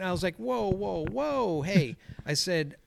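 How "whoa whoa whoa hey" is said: high-pitched "stop right there" call; mock yell to halt someone, pitched up; retold calling out. Worker flagging down a dad on a bike, retold calling out.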